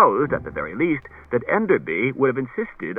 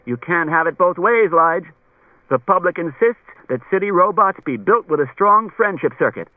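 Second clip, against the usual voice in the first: commissioner's voice; 5 semitones higher, 5 dB louder than usual. A narrator's reading. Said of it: "the commissioner had shrugged his shoulders and said".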